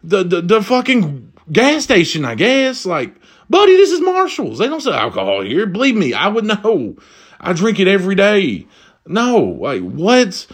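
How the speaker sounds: high-pitched exasperation; incredulous rant. Retold or imitated. Shopper tells Batman this is Marshall's, high and incredulous. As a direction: protest in rising disbelief.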